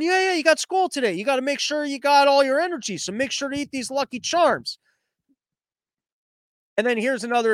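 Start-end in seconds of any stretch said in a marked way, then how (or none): 0.0-4.8 higher-pitched, oblivious parent voice; hammed-up parent hurrying a kid along; mock clueless parent. Clueless parent sending a kid to school on Lucky Charms, mock parent voice.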